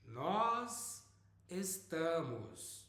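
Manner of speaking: nós estamos drawn out slowly; slow model phrase. Shown as a demonstration. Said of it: "we are going to be learning estar".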